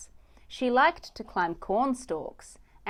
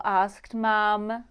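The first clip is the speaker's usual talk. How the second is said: ordinary delivery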